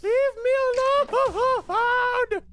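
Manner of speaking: very high, very slow shriek; shrieked plea. Real, not acted. Gamer cornered by a mob, shrieking plea. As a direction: scream it out long and high.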